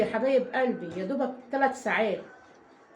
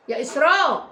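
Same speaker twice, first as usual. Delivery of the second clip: raised voice calling Esraa across the house; 4 semitones higher, 8 dB louder than usual. Real, not acted. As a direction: call her name loudly, higher. Calls out to daughter Esraa. (تنادي بصوت عالي على إسراء)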